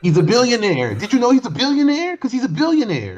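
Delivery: high, loud mock fan bragging. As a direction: squeal it like a smug fan. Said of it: "mocking [name] fans".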